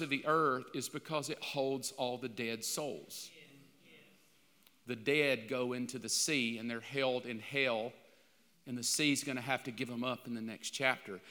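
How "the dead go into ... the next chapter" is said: ordinary delivery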